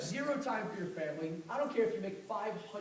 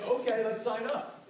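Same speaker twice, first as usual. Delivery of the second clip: louder, higher and slow, keen to sign up; a prospect gladly agreeing, played louder and slower; eager prospect; 6 semitones higher, 8 dB louder, slower than usual. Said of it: "some people will say".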